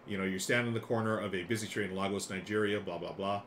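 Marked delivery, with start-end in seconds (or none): none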